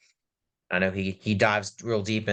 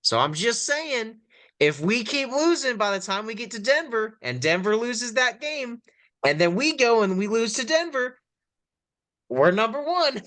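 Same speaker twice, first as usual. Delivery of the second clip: excited; 11 semitones higher than usual. Real, not acted. Joking about tanking for the top pick.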